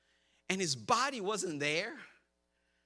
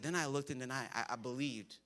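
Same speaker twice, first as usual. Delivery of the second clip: much softer and lower, halting with stammers; hesitant confession; 7 semitones lower, 8 dB quieter than usual. Retold or imitated. John admitting to Peter what he believed, soft and halting. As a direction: let the words stumble out sheepishly.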